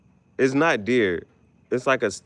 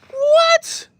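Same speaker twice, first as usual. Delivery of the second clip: high squealed disbelief; shocked what; 8 dB louder than usual. Real, not acted. Reacting to kangaroo news, high shocked squeal. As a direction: shriek it in disbelief.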